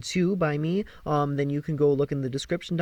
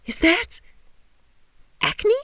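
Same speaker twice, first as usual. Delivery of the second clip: aghast; 4 dB louder than usual. Acted. Apollo aghast at his face.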